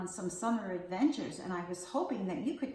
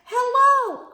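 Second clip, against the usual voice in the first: cheery call up; 16 dB louder than usual. Acted. Busy greets bird bugs.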